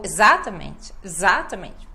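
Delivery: "exatamente" punched on ZA; loud ZA in "exatamente", tail quickly dropped; misplaced stress. Retold or imitated. Teacher showing how the word changes, wrong stress on ZA in "exatamente". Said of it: "Muda totalmente".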